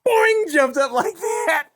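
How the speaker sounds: high, loud, slow talk through laughter; laughing while telling. Real, not acted. Dad telling the prank while laughing. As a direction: barely get it out for laughing.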